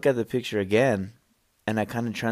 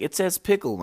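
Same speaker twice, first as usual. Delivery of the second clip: higher goofy voice; a dumb voice from the back; random guy in the back; 8 semitones higher than usual. Retold or imitated. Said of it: "some guy in the back's like".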